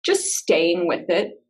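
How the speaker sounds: unhurried meditation guide with long pauses; calm, spacious instructions, leaving silence between phrases; guided meditation. Real, not acted. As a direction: ease listeners gently into body awareness.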